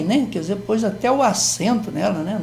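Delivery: ordinary talk, nothing marked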